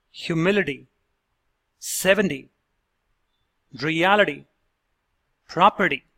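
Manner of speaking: "Humility", "seventy", "reality", "property" with T as a fast D; careful word-by-word modeling; flapped T model words. Shown as a demonstration. Coach demonstrating the fast D in -ty words. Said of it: "Let's listen to the words".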